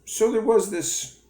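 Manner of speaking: high, slow, drawn-out story opener; imitating the rabbi's storyteller opening. Quoting the rabbi to viewers, high slow storyteller voice. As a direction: mimic the rabbi launching his tale. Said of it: "He starts with a story".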